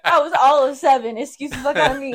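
loud sarcastic self-defence; indignant comeback. Real, not acted. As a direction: fire back in playful outrage. Defends crying as a child.

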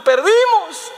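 high, wailed imitation of a boy; mock crying child. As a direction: bawl it like a child who lost, hammed-up. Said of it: "Estaba llorando".

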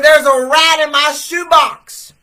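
high, loud and slow, pushing harder. Retold or imitated.

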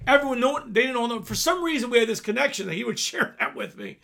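ordinary talk, nothing marked

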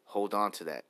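normal talking voice

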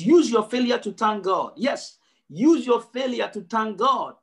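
ordinary talk, nothing marked

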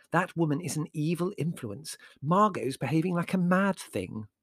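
lightly voiced alarmed relative; disapproving woman. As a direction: warn with prim disapproval.

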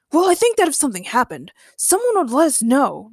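ordinary delivery, nothing marked

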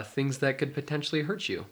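ordinary talk

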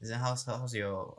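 normal talking voice

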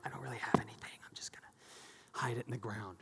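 hushed, whispered servant excuse; whispering timid servant. Retold or imitated. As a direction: murmur it like a timid servant hiding his coin. Lazy servant voiced in parable.

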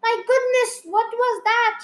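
high, loud exclamation of shock; startled cry. A narrator's reading. Mother hearing the tummy noise, startled cry. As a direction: cry out in storybook alarm. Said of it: "she cried".

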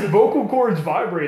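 blurted out through laughter; laughing. Real, not acted. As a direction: laugh it out loud.

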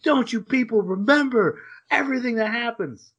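high-pitched, anguished mock outburst; freaked-out yell. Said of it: "freaks out and yells at her".

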